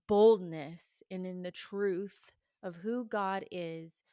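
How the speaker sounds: a rising preacher's cry of boldness; rousing call. Real, not acted. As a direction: proclaim it boldly and slowly.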